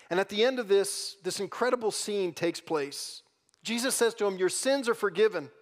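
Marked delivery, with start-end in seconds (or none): 0.1-4.4 loud, high, rising preaching voice; impassioned preaching. Acted. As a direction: build the sermon up to a near shout. Preaching builds to full force.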